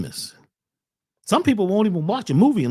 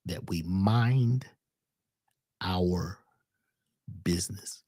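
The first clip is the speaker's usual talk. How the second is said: slow, sinking lower and softer until a whisper on business; fading to a whisper; 11 semitones lower, 7 dB quieter, slower than usual. Acted. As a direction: let the words drop away into a hush. Slow fade into a whispered point.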